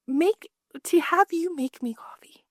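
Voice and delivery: whispered. Retold or imitated. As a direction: whisper it slowly, as the stunned mother. Mom's quote trails into whisper.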